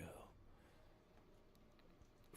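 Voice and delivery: talking through hiccups; slow speech broken by hiccups. Real, not acted. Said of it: "Got the hiccups".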